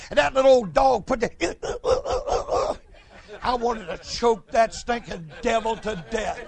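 usual speaking voice

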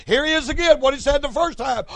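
ordinary delivery

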